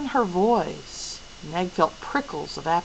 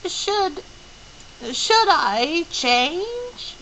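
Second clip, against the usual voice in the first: put-on hesitant high old woman; 10 semitones higher, much slower than usual. A narrator's reading. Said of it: "Missus Watson asked".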